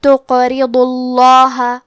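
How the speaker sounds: تقرضوا اللہ میں اللہ کا لام موٹی آواز میں; آہستہ، اللہ کے لام کو بھاری کریں; لام کی تفخیم. Shown as a demonstration. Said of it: "لام کو موٹی آواز میں پڑھیں گے". (لفظ الجلالہ کا لام موٹی آواز سے)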